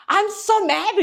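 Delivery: high-pitched comic fuming; mock anger. Imitating upset Western listeners, in mock anger. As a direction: imitate someone hotly indignant, pitched well up. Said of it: "화가 너무 난대요".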